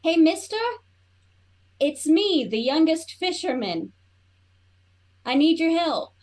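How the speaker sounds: yelling out. Acted. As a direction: call out loudly for help. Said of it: "I'm gonna yell out".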